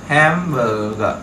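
Hamburger said very slowly, HAM stressed first; stress on first syllable. Shown as a demonstration. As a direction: stretch out Hamburger, leaning on HAM. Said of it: "trọng âm đầu tiên chúng ta là hám".

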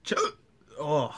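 ordinary talk

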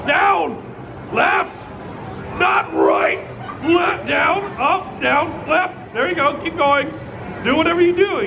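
high, urgent called-out directions; calling out directions. Real, not acted. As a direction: shout each direction to the player. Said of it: "I'm gonna call out the direction".

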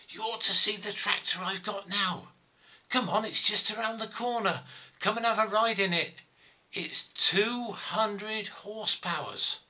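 loud, high, proud show-off; excited boasting. Retold or imitated. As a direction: brag keenly about your big new machine. Old friend boasts about his tractor.

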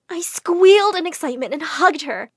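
excited. A narrator's reading. Excited narration.

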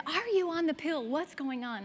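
demanding mom. Retold or imitated. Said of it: "she said".

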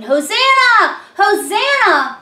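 usual speaking voice

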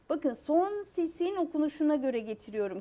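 ordinary delivery

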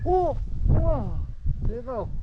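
high, loud whoop of delight; excited cheer. Real, not acted. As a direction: cheer the hit at full voice. Cheering a big hit.

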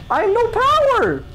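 laughing high yelp. Real, not acted. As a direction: laugh out the bad news. Power runs out in game.

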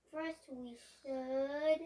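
ordinary delivery

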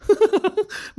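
short high teasing giggle; a high amused giggle. Acted. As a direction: giggle at their confusion. Laughing at his friends.

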